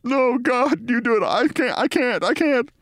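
high, whimpering refusal; mock anguished mumble. Mortified rival refusing to tell, hammed-up high whimper. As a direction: plead helplessly in a high put-on voice. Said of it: "shakes his body no and mumbles".

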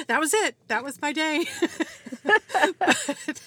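loud laughing through the words. Real, not acted. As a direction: laugh it out, amused at yourself. Laughing at her coffee list.

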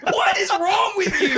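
high, loud, slow laughing outburst; cracking up in disbelief; laughing exasperation. Real, not acted. Laughing at hypochondriac dad.

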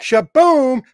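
high, loud celebratory whoop; excited shout. Real, not acted. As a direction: belt it like a cheer. Celebrating caller's progress.

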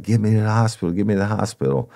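high, pleading repeated plea; pleading victim. Retold or imitated. Burned man begging the cop, high pleading voice. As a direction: beg to be taken to hospital, voice lifted.

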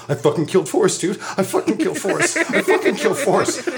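a friend sure he just killed someone, retold with a laugh; imitated panic. Joe panicking after the fall.

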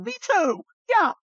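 very high, cartoonish agreement; squeaky dog voice. Same high comic voice.

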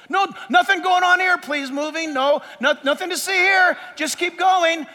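high, urgent crowd-control patter; mock-officious calling out; flustered legalist. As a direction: wave onlookers along in a hurry. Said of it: "a legalist would run around".

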